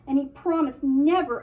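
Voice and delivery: ordinary delivery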